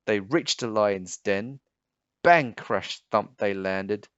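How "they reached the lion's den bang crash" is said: usual speaking voice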